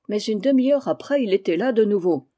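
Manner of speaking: ordinary delivery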